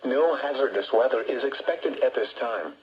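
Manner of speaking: ordinary talk, nothing marked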